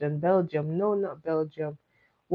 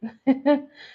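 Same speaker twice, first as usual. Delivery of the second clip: burst of laughter; 8 semitones higher, 6 dB louder than usual. Real, not acted. Laughs at viewer's answer.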